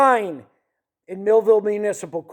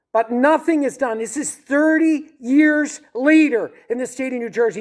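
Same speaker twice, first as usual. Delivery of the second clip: outraged complaint; 7 semitones higher than usual. Real, not acted. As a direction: hammer home the injustice.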